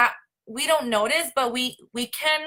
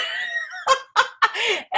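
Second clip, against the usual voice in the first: big laugh. Real, not acted. Laughing at own mood swing.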